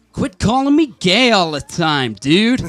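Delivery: put-on loud, slow American dude accent. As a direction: do the American friend's voice, drawn out. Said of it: "give me your best American accent".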